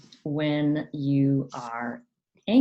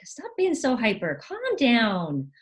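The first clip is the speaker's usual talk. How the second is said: high-pitched mock telling-off; chiding parent; 7 semitones higher, 3 dB louder than usual. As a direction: imitate a parent squashing excitement. Parent voiced shaming excited child.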